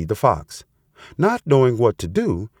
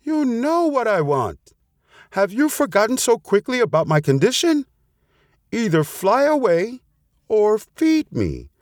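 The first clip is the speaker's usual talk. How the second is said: high-pitched demand, slowing at the end; storybook fox's shout without real volume; mock shouting; 12 semitones higher than usual. A narrator's reading. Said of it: "shouted at the top of her lungs".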